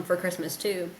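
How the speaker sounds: usual speaking voice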